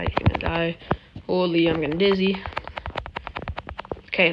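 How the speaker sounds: normal talking voice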